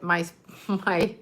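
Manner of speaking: breaking into giggles mid-word; laughing. Real, not acted. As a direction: giggle through the words. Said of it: "I'm laughing".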